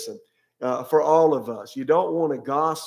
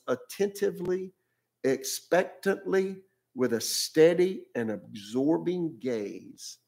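slow, weighted reading of each word; slow emphasis; 2 semitones higher, 3 dB quieter, slower than usual. Real, not acted. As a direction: draw out each word to let it sink in. Preacher savours key scripture words.

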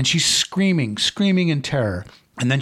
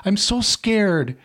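crying out in fear; 9 semitones higher than usual. Acted. Girl alone in the car crying out to Johnny, high and terrified. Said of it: "She cries out".

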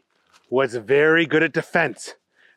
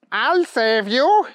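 high, slow, drawn-out cry; heroic call; 9 semitones higher, 4 dB louder, slower than usual. Acted. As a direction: call down to someone falling. Hero calls to falling bunny.

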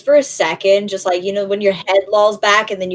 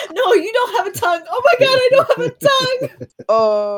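much higher, fast panicked wail; mock panic. Character realizing she lacks a tongue, hammed-up crying panic.

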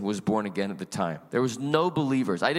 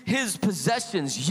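usual speaking voice

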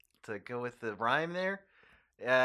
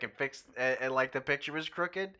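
usual speaking voice

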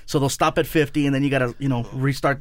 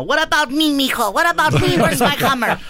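much higher, louder pleading mom voice; mock whiny mom; 10 semitones higher, 4 dB louder than usual. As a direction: imitate mom demanding her own Hummer. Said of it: "my mom was like".

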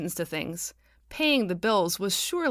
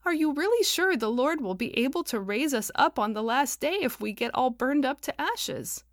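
higher, wavering worried voice; quavery; 7 semitones higher than usual. A narrator's reading. Hazel asking the council about resurrection, quavery and higher. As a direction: let the voice tremble. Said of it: "in a quavery tone".

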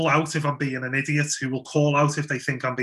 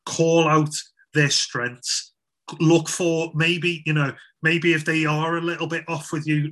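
normal talking voice

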